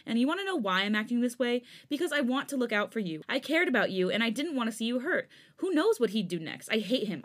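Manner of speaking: heated justification; angry. Acted. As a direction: shout out his reasons. Changbin angrily justifying himself.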